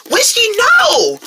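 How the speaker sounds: high, drawn-out cry of alarm; alarmed warning. Acted. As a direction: stop a friend with a long, high shout. Friend stops cat entering lion exhibit.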